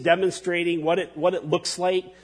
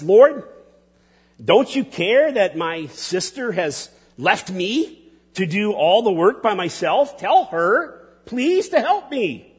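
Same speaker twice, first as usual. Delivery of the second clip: higher, pleading and put-upon, rising to an indignant demand; Martha's exasperated complaint; 4 semitones higher, 3 dB louder than usual. Acted. Martha complaining to Jesus about her idle sister, exasperated. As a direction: whine it at the Lord like an overworked hostess. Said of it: "notice, um, Martha's tone".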